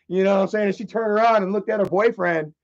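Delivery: ordinary talk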